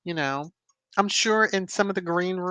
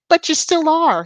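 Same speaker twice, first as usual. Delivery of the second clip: indignant outburst; 12 semitones higher, 9 dB louder than usual. Real, not acted. Scolding the press.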